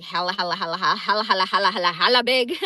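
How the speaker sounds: laughing, growing "hella" chant; building comic repetition. Real, not acted. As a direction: pile on the hellas, cracking up. Joking about his size.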